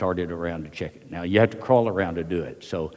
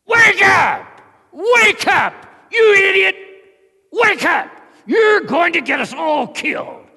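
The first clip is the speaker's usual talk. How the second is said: full-force high scream in his face; screamed wake-up; 13 dB louder, slower than usual. Retold or imitated. Soldier rousing a sleeping guard on the front line, screamed. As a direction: scream the sleeping comrade awake in fury. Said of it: "I screamed at him".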